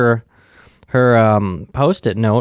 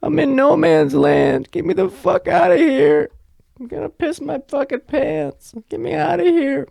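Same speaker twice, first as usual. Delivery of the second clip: put-on drunk, very high-pitched demanding voice; Sheila. Drunk woman begging to get out of here, high and frantic. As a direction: drag out the pleas in a high drunken squeal. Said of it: "I'm literally Sheila when I'm drunk".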